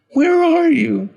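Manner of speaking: very high, very loud call; shrieked little-boy plea; desperate child scream. Acted. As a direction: scream for a missing friend. Boy screaming for missing friend.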